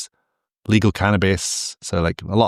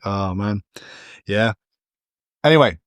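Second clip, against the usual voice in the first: ordinary talk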